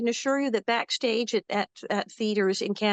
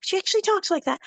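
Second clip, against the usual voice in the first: amazed; 8 semitones higher than usual. Retold or imitated. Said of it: "they're like".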